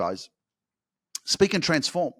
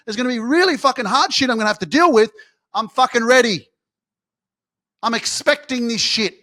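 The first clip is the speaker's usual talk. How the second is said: starts firm, climbs into a loud, high fired-up shout; building rant; 7 semitones higher, 7 dB louder than usual. Real, not acted. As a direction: pump yourself up harder with every line until you're yelling.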